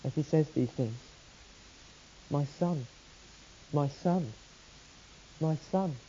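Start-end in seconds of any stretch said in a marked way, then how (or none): 2.3-6.0 soft, breaking repetition of my son; father's voice cracking with grief, softer. Retold or imitated. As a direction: let the voice crack on each my son. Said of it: "you can hear his voice crack".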